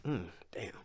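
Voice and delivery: real tears, barely a whisper under the breath; soft breathy curse fighting off crying; holding back tears. Real, not acted. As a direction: choke it back, almost silent. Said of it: "I'm about to get emotional".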